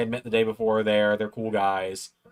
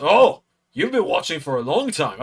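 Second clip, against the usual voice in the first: high, loud wrestler impression; Joe Hendry; 6 semitones higher, 5 dB louder than usual. Wrestler realises how long the fan has watched, impersonated. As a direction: imitate an impressed star with swooping pitch. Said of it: "not the best Joe Hendry impression".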